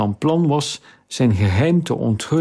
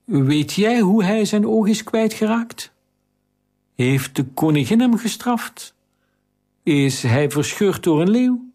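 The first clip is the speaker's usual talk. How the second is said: put-on high, curious girl's voice; a much higher heroine probing; young girl's question; 9 semitones higher than usual. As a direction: ask eagerly like a young girl. Girl speculates eagerly.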